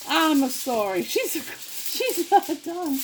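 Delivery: high, hammed-up Italian accent. Cook getting goofy in the kitchen, mock Italian accent. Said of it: "I could do my Italian accent".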